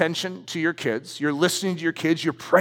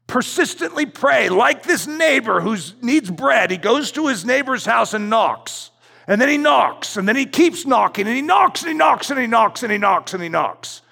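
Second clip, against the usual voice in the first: preaching at full force; 5 semitones higher than usual. Acted. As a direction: build the repeated knocking like a revival preacher.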